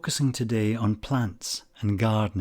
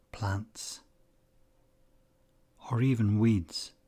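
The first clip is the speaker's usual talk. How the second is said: unhurried meditation guide, long pauses between phrases; calm, measured guiding voice, phrase endings gently falling away; guided meditation. Acted. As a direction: ease the listener into calm focus, leaving space after each phrase.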